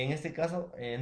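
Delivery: ordinary talk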